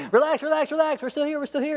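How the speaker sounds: very high-pitched, hurried pleading; hammed-up urgent calming; frantic reassurance. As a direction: talk someone down in comic alarm. Frantically reassuring the suicidal jailer.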